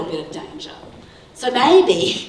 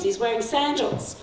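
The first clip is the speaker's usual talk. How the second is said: ordinary talk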